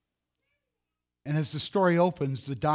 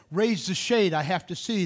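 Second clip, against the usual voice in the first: urgent plea; 5 semitones higher, 5 dB louder than usual. Acted. Sick girl demands the shade.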